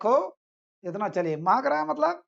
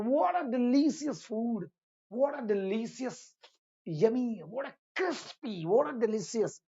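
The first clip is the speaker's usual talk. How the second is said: slow example phrase; 3 semitones higher, much slower than usual. Shown as a demonstration. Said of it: "अब ऐसे साथियों use करेंगे ना".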